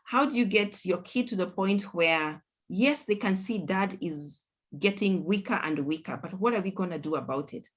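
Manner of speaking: ordinary delivery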